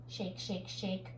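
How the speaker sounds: ordinary talk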